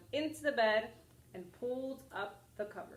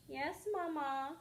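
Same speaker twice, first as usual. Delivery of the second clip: put-on sweet little girl; 6 semitones higher than usual. Obedient child answering mother.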